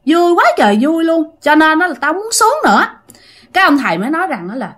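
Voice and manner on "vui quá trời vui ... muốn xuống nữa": high, gloating possessed voice; acted glee of a spirit; gleeful spirit. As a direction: revel in being here, pitched up. Spirit gloats about entering the girl.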